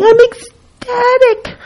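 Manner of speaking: very high, drawn-out ecstatic cry. Shown as a demonstration. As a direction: stretch it out high, overjoyed to demonstrate the top of the scale. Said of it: "The volume of emotion".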